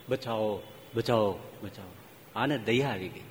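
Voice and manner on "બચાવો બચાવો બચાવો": low, softer, fading cry for help; faint snake plea. Retold or imitated. Frozen snake begging the passer-by, low and faint. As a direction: beg weakly like a dying snake. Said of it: "એ સાપ જ બોલતો હતો".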